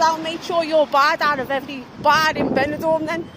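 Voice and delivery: loud, furious threat; retold row, loud furious threat; loud angry threat. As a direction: hurl the threat at her.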